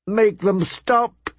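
slow, high, anguished plea; a mother crying out in despair. Acted. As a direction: beg through tears. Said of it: "she cried".

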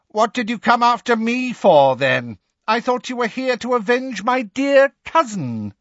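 high, mocking goad; jeering witch, pitched well up; taunting villainess. A narrator's reading. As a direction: taunt your hidden prey in a high voice. Bellatrix taunts the hiding Harry.

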